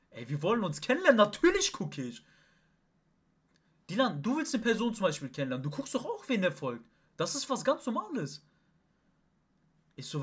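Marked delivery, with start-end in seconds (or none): 0.1-2.2 loud, high-pitched indignant protest; retold argument at full voice; heated retort. 3.9-8.4 loud, high-pitched insistence; worked-up protest, pitch leaping up; heated justification. Real, not acted. Arguing his case from the quarrel.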